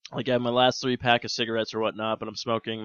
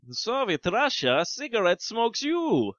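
mock Russian accent; 8 semitones higher, slower than usual. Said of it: "accent would be".

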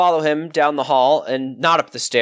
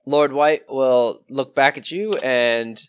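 normal talking voice

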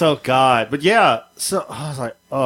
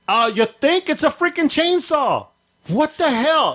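alarmed; 13 semitones higher, slower than usual. Retold or imitated. Voicing himself in alarm.

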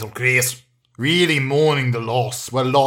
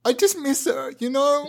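sad son; 10 semitones higher than usual. Acted. Son admits missing mum.

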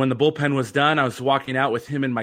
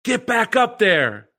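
booming yell; 10 semitones higher, 4 dB louder, slower than usual. Retold or imitated. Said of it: "Same thing".

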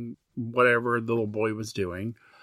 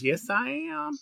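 Leslie Jordan; 14 semitones higher, 5 dB quieter than usual. Retold or imitated. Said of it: "He goes".

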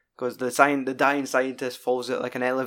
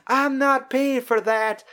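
much higher, slow, prim woman's voice; haughty shopper woman; 12 semitones higher, 4 dB louder, slower than usual. Retold or imitated. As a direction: play an affronted lady at the till, higher and slower. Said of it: "and the woman's like".

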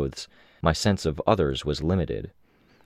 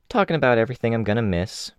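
higher and fast, breezy; quick playful Imp; 6 semitones higher, much faster than usual. Acted. Imp explains to Taylor, fast and higher. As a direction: rattle it off lightly. Imp's voice in dialogue.

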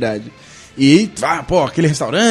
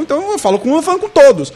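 rising protest; 10 semitones higher than usual. Retold or imitated. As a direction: argue back with rising outrage. Arguing with the waiter.

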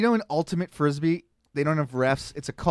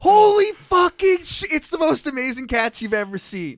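amazed shout; 11 semitones higher, 6 dB louder than usual. Retold or imitated. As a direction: scream it in disbelief. Commentator at amazing catch.

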